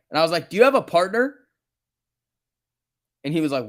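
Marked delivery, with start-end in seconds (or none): 0.5-1.4 higher and louder, a quick rising question; quick retold question. Streamer first asks the rude paramedic, quick and rising. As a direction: toss it off fast with a rising tune.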